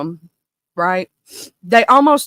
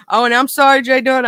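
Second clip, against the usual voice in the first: ordinary talk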